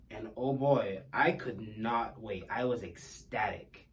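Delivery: ordinary talk, nothing marked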